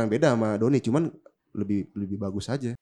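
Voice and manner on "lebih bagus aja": ordinary talk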